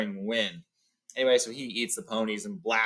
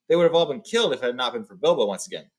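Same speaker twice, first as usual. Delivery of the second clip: plain reading voice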